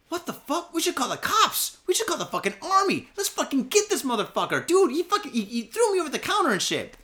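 high-pitched, worked-up outburst; incredulous protest. Retold or imitated. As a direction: re-enact outraged pleading with a spineless boss. Incredulous outburst at boss's caution.

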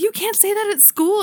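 high, lightly scolding mom voice; mock scolding parent. Mother to her daughter, a high mock scolding. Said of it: "I'm like".

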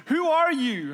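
high, loud startled plea; mock panic as the voice appears; alarmed Paul. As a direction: blurt it out, caught off guard. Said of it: "And he goes".

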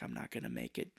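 faint low whisper of a dying man; dying rasp. Retold or imitated. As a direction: croak it out with your last strength. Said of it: "He's like".

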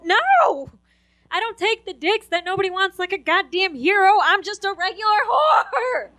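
high, loud mock outrage; indignant yell. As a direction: protest loudly, drawing out the final word. Acted yelled answer to question.

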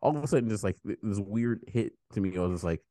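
ordinary talk, nothing marked

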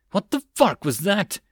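mild narrated scream of shock; screamed over gunfire. A narrator's reading. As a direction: cry out in alarm under fire. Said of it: "he screamed over the gunfire".

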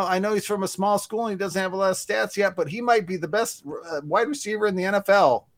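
high, lightly put-on broadcaster voice; analyst impression. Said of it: "that wasn't so good".

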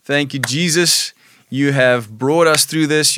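ordinary delivery

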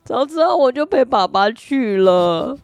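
high, drawn-out mock sobbing child; imitated crying child. Child regrets not going with father, imitated tearful whining. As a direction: wail it slowly like a regretful little kid. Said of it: "他就一边哭一边说".

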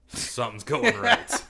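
laughing through the words. Real, not acted. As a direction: chuckle while saying it. Laughing at his own remark.

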